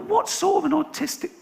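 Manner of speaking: high exasperated squeak of disbelief. Retold or imitated. Exasperated thought.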